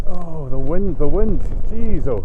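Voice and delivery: loud, high exclamations against the wind; loud over the wind. Real, not acted. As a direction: shout it over a howling gale. Battered by strong wind.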